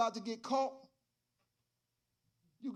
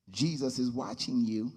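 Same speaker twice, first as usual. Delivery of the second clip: mysterious voice; 9 semitones lower than usual. Acted. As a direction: speak low like an unseen watcher in the room. Unseen voice speaks to the burglar.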